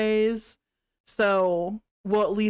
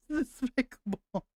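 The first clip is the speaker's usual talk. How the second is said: laughing; 4 semitones higher, 3 dB quieter than usual. Real, not acted. Laughs while repeating word.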